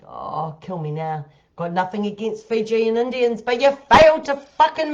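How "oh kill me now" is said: mock despair. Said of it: "And I'm like".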